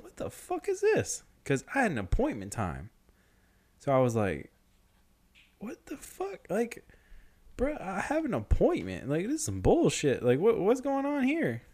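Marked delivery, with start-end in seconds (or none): 0.0-1.2 very high-pitched disbelief; incredulous. Retold or imitated. Man with appointment facing a long line, high incredulous. 5.6-11.6 high-pitched mock indignation; incredulous complaint. Man with appointment finding a queue, high and indignant.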